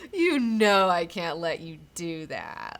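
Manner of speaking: slow, loud, mock-solemn warning; firm warning. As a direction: stretch the words out as you forbid it. Acted confrontation, John stops Rorschach.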